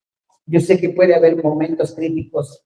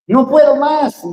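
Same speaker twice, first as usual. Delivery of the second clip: much higher, louder cry of giving up; despairing cry; 9 semitones higher, 6 dB louder, slower than usual. Pretend. Spouse giving up on everything, in a high despairing cry. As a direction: break down in despair.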